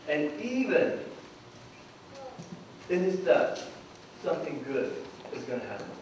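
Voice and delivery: soft, low, unhurried reassurance; quiet, patient teacher's tone; gentle explanation. Retold or imitated. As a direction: break it to them gently. Jesus gently explains the death.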